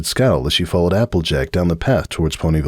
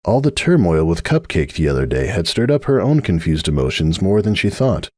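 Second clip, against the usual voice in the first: ordinary delivery, nothing marked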